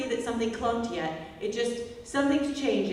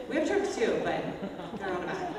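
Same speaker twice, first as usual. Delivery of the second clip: ordinary delivery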